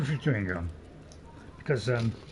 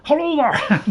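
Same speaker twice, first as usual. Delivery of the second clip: put-on Scottish accent; 10 dB louder, slower than usual. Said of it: "Scottish, you know me, I can do it".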